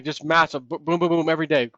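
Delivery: ordinary delivery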